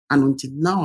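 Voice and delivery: ordinary talk, nothing marked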